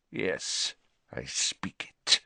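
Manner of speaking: low, slow hissing snake-speech; cold hissed reply in snake-tongue; Parseltongue. Acted. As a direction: answer in a breathy serpent hiss. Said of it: "Riddle spoke in Parseltongue".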